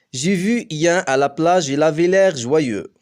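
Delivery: joyeux said slowly and clearly in French, each word separate; slow example sentence. Shown as a demonstration. Teacher reading a French example sentence slowly to pupils. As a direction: read it slowly like dictation. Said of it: "ستنطق جوايو".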